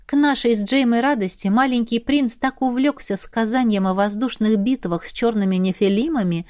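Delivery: ordinary delivery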